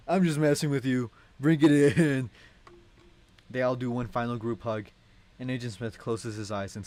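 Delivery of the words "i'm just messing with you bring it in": louder, higher, warm laughing call; cheerful invitation. Acted. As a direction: laugh and open your arms to the kids. Agent laughs it off.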